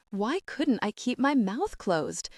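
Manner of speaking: plain reading voice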